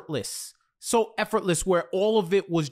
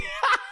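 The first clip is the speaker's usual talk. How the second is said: laugh. Real, not acted. Laughing at the quote.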